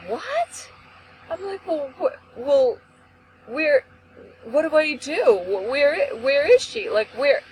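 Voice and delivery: high-pitched stammering disbelief; flustered, rising questions that keep restarting; retold shock. Daughter to police officer after accident news, shocked.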